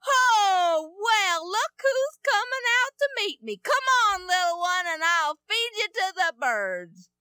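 loud, high mocking taunt; hammed-up angry giant taunting; mad giant. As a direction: jeer at the little boy coming out. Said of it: "he's so mad".

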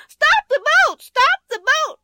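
put-on high, loud child's plea; Benny crying out. A narrator's reading. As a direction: beg the captain like a frantic small boy. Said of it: "he cried".

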